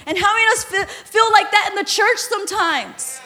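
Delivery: normal talking voice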